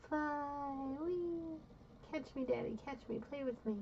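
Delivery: put-on soft, high little kid's voice; small child playing. Toddler calling to daddy to catch, small and high. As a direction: beg sweetly like a toddler at play.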